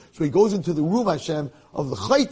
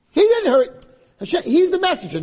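much higher and louder, pressing the point; emphatic outburst; 14 semitones higher, 6 dB louder than usual. Real, not acted. Rabbi to listeners, insisting excitedly. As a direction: jump up to drive it home.

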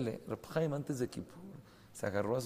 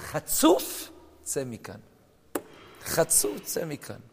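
furious shout; 3 semitones higher, 6 dB louder than usual. Retold or imitated. Angry man insults the rabbi.

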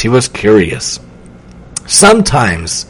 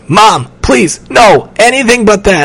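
high-pitched begging daughter; desperate pleading; 7 semitones higher than usual. A narrator's reading. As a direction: plead with mom to be let off. Girl begs mother.

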